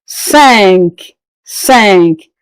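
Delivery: cinq said sank; French cinq. Shown as a demonstration. Teacher drilling cinq to learners. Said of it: "We have cinq".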